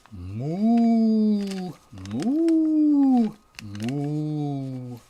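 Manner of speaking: rising then falling moos; mooing cow. Acted. Narrator mooing as the cow the pig hears. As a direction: moo like a cow. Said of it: "It was a lovely".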